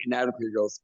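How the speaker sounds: ordinary talk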